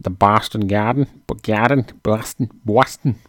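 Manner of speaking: exaggerated mock Boston accent on Boston and Garden; hammed-up Boston accent. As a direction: try out a broad Boston accent, playfully. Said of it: "That's my Boston accent".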